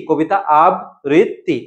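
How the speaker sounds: আবৃত্তি in slow pieces, ab-brit-ti; very slow, segmented আবৃত্তি; syllable-by-syllable pronunciation. Shown as a demonstration. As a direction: break আবৃত্তি apart, holding the double t. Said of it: "উচ্চারণটা কি হবে বলো তো".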